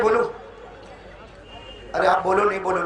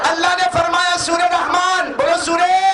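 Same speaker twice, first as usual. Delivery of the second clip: high, ringing call to the crowd; full-force preaching; 9 semitones higher, 5 dB louder than usual. Acted. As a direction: rouse the congregation in a raised voice. (जोशीली तक़रीर, ऊँची आवाज़ में)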